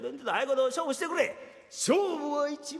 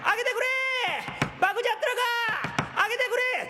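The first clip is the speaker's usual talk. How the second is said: higher, louder shout from outside the door; loud calling out; 10 semitones higher, much faster than usual. Acted. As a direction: call out to be let in, loud. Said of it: "表で大きい声出して". (表から大声で「あげてくれ！」と叫ぶ)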